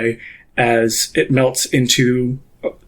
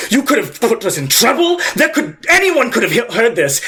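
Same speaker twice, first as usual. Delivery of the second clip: higher, tense hissed scolding; panicked scolding Beerus; 7 semitones higher than usual. Acted. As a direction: scold them in a frightened rush.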